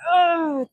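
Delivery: sharp high strained grunt; effort grunt. Real, not acted. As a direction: grunt through the hardest rep. Grunt mid-rep under load.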